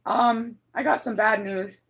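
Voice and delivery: ordinary delivery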